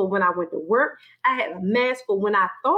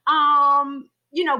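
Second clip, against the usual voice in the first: higher, preening self-appraisal; mock vain self; 7 semitones higher than usual. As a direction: admire yourself in the mirror, lightly mocking. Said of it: "I was like".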